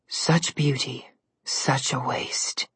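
slow, drawn-out whisper; eerie whisper. A narrator's reading. Unseen mirror voice admires Goldilocks, eerie slow whisper.

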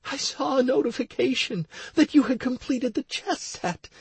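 tearful, high-pitched woman's voice; Stacy. A narrator's reading. Crying wife begins to explain to her husband, tearful. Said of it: "still crying her eyes out".

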